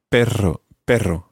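"perro" with a trilled RR; rolled double R. Shown as a demonstration. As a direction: roll the R firmly in "perro". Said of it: "double R".

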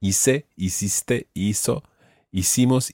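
hice, hiciste, hizo, hicimos with s for c and z; Latin American pronunciation. Shown as a demonstration. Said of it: "using Latin American pronunciation".

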